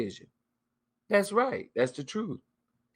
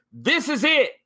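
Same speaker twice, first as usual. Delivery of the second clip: high, bright mock announcement, louder; excited reveal; 12 semitones higher, 4 dB louder than usual. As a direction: proudly show off what was bought. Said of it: "I'll come back on here and say".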